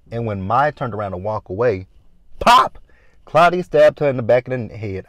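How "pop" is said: loud, high, punched pop; sudden pop. Retold or imitated. Recapper imitates the ice pick hitting, loud sudden pop. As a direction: punch out a sudden impact noise.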